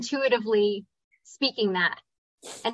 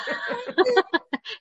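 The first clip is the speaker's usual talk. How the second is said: delighted laugh; 10 semitones higher than usual. Real, not acted. Tender laughing reaction.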